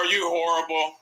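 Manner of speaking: ordinary delivery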